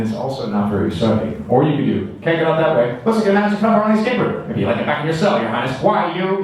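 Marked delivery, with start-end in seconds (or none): 2.2-6.4 put-on fast, higher-pitched rapid-fire old movie dialogue; nineteen forties pace. Shown as a demonstration. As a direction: rattle it off like a forties adventure serial. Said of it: "nineteen forties pace of the dialogue".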